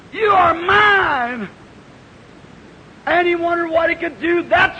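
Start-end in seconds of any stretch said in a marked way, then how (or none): 0.1-1.5 mother eagle's call. Acted. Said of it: "The old mother said".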